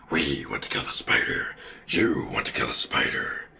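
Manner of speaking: very low, hushed monster rasp; symbiote voice. Acted. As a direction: whisper it deep like a creature in control. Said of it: "It would say "we".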